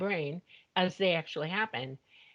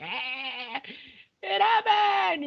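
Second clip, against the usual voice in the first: a stretched, high, loud cry of triumph; elated outburst; 9 semitones higher, 4 dB louder, much slower than usual. Retold or imitated. To mom, jumping in the kitchen, a slow, loud elated cry. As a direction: slowly burst out in joy, much higher and louder.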